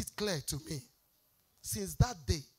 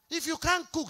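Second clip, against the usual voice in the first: ordinary talk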